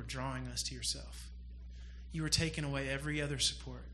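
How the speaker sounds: soft, low, hushed prayer. Acted. Preacher prays for the congregation, soft and low. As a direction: speak softly to the Father.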